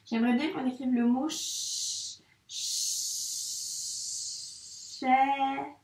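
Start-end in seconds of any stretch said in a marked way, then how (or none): 1.3-5.8 long held "ch-" hiss before "chaîne"; "chaîne" drawn out sound by sound. Shown as a demonstration. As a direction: stretch "ch-" while writing, then ease into "chaîne". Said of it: "observe bien ce que j'écris, écoute bien".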